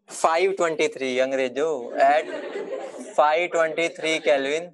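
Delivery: very slow, word by word; drawn-out dictating voice; slow dictation. Shown as a demonstration. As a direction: spell it out for them to write down. Teacher dictating question to class.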